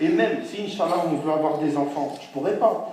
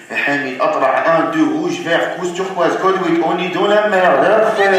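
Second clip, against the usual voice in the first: loud code-calling waiter; walkie-talkie waiter; 7 dB louder, slower than usual. Acted. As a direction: bark codes into the radio. Serveur parle au talkie-walkie. (serveur qui crie des codes au talkie)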